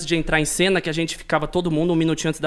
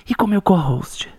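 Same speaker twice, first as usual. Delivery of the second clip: ordinary talk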